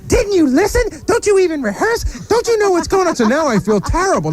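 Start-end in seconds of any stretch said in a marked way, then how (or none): 0.1-3.2 much higher, mock scolding voice in a put-on timbre; Robin mocking scold. [name] mimics Robin scolding him at rehearsal, higher mock tone. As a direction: lampoon her shrill put-down, higher. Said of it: "She goes".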